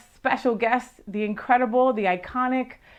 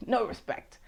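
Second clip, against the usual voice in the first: a soft aside echoing the line; muttered catchphrase; 8 dB quieter than usual. Retold or imitated. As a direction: let "no respect" fall away under the breath. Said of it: "That was Rodney Dangerfield".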